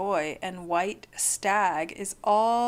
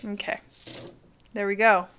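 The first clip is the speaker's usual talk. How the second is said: ordinary delivery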